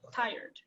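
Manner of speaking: "Tired" said as a single syllable; "Tired" run together as one syllable. Shown as a demonstration. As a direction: say "Tired" in one beat, no split. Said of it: "Tired is one syllable".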